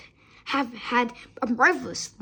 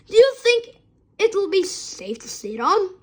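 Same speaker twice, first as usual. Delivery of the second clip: put-on 1 doubtful older girl; 8 semitones higher, 3 dB louder than usual. A narrator's reading. Older girl asks worriedly.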